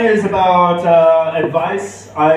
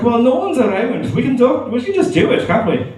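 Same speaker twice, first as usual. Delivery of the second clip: Scottish dad; 7 semitones higher than usual. Retold or imitated. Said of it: "I'm not too bad at a Scottish accent".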